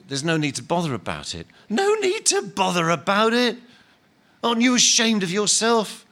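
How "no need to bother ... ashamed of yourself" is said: higher, louder indignant shout; outraged disbelief. Acted. Big ghost scolding Len for not being ashamed, loud. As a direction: fling his words back with scorn.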